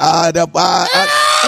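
exaggerated, pitch-jumping character holler; Arthur's Jerome Rome voice. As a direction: shout it as a hammed-up impersonation. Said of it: "now he was like".

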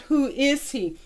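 ordinary delivery

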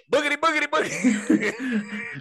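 high, excited, stretched-out announcer call; race announcer catchphrase. Retold or imitated. Imitating the Daytona announcer for friends, in an excited high call. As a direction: yell it like a NASCAR commentator at the start. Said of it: "he had that hard accent".

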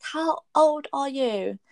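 heated mock confrontation, rising in pitch; indignant retort. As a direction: retell it as an incredulous comeback, drawing out the first question. Retelling her angry retort to him.

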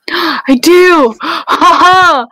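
gasp, then crow it with a big "ha ha"; gleeful triumph. Real, not acted. Player told of another attack, gleefully triumphant.

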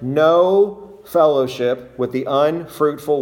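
"no" and "unfruitful" drawn out and emphasised; slow down, leaning hard on "no" and "unfruitful"; stress on no, unfruitful. Shown as a demonstration. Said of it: "Notice the emphasis on the word".

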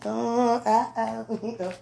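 ordinary talk

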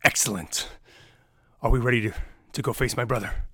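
pleased orc warrior, a hint of gruffness; Brachus praising. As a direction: Brachus praises the party's teamwork, lightly voiced. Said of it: "and he sees".

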